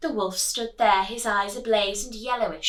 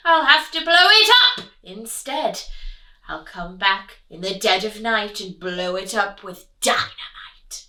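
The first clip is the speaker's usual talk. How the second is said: loud plotting wolf; a hint of villain; scheming wolf; 2 semitones lower, 9 dB louder than usual. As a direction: vow revenge. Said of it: "Wolf said".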